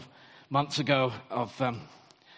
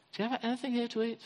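quick, softer, offhand asking; casual quick Jesus; 7 semitones higher, 4 dB quieter, much faster than usual. Shown as a demonstration. Preacher re-voicing Jesus as casual and inviting, quick and soft. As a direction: toss it off lightly, like an easy invitation.

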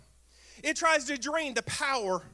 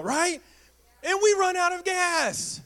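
higher and louder, laughing into it; preaching at full force; 5 semitones higher, 4 dB louder than usual. Acted. Pastor fires up the congregation about running out of gas, loud. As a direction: preach it big and rising.

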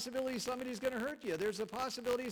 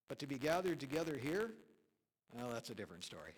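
quoted pastor's deflating aside; 7 semitones lower, 4 dB quieter than usual. Retold or imitated. Preacher lands the pastor's joke about church here, deflating. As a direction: drop from exultation to a quiet, dry finish. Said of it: "And this pastor said".

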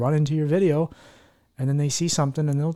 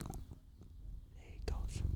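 hushed, slow whisper; breathless little whisper at the cat; 23 dB quieter, much slower than usual. Real, not acted. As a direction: whisper so the purr stays on mic. Cat finally purrs into mic.

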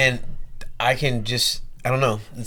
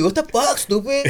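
much higher put-on insult; movie quote voice; 12 semitones higher, slower than usual. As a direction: mimic a film character. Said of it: "in some of the movie".

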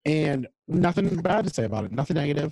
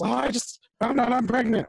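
imitated yell; 9 semitones higher than usual. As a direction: blurt it out as a dramatic imitation.

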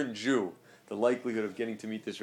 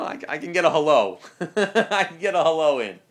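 loud, higher, amused boast breaking into laughter; laughing; 5 semitones higher, 13 dB louder than usual. Real, not acted. Teacher joking about his access to the busy rabbi, laughing. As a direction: laugh through it, loud and tickled.

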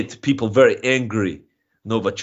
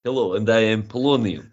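hammed-up Russian accent; broad comic Russian accent; mock Russian accent; 3 semitones lower than usual. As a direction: play it as a proud Russian comedian in a thick Russian accent. Comic Russian guest persona.

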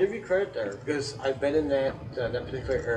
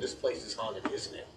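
falling, softer statement rather than a question; understated, knowing assertion; 3 semitones lower, 7 dB quieter than usual. Retold or imitated. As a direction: quote it quietly as a matter-of-fact statement, no questioning lift. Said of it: "I didn't say it as a question".

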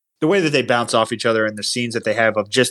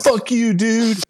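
mock outrage; 12 semitones higher, much slower than usual. Friend mocks late viewing.

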